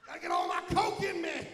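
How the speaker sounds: high, loud, desperate cry for soda; frantic greed. Acted. Comedian acting out his soda greed, frantic high yell. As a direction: yell it like a Coke addict. Acted greedy slurping moment.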